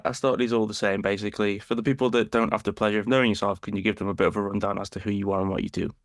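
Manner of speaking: normal talking voice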